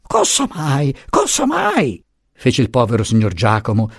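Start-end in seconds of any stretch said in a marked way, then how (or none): 0.0-0.9 slightly raised flustered question; startled first cry. A narrator's reading. Giacomo flustered, repeating. 1.1-2.0 much higher, alarmed repeat; panicked. A narrator's reading. Giacomo flustered, repeating.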